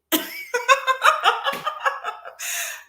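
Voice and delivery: rising high laugh; high laughter. Real, not acted. Laughing at her own point, high rising laugh.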